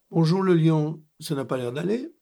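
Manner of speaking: appelle le lion, plus fort; lance-le pour qu'il entende. Acted. Interpelle le lion de loin. (le narrateur interpelle le lion, à voix forte)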